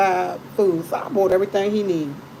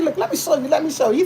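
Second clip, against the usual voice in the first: higher, eager imitation of her brother; 6 semitones higher than usual. Sister mimicking her brother eagerly offering to demonstrate, higher voice. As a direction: pitch up and jump in like him.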